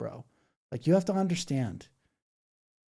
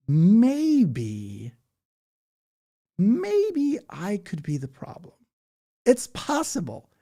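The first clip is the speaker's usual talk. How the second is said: mock self-reflection; 7 semitones higher, 5 dB louder, much slower than usual. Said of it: "I need you to go".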